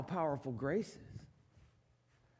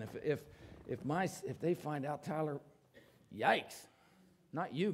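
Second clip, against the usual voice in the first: ordinary talk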